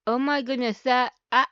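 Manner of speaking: ordinary delivery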